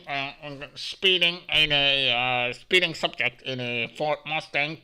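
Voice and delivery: loud mock police dispatcher, spelling it out deliberately; police radio call. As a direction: call it in over the radio like a cop. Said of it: "the police had to go".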